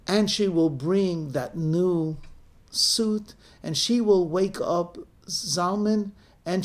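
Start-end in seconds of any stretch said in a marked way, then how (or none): none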